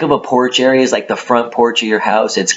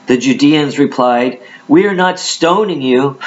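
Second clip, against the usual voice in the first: raised, emphatic Bible reading voice; dramatic scripture reading; 5 semitones higher than usual. Acted. Preacher reads Jesus answering the Judeans, dramatic scripture reading. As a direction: declaim the verses at a higher pitch.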